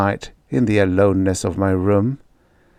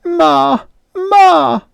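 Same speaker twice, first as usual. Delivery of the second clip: very high, loud cry for mom; frightened wail; 6 dB louder than usual. Acted. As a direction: run home crying for mother. Bully fleeing, calling mother.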